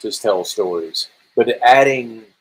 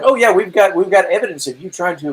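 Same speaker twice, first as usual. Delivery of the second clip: mock accusers; 7 semitones higher, 3 dB louder than usual. Acts out accusers in court.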